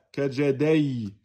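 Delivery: kajadai ending in a slow "ay" glide; wrong ya soukoun. Shown as a demonstration. Teacher mimicking the mistake, gliding ending. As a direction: end kajadai on a diphthong, ay. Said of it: "elle ne me dit pas".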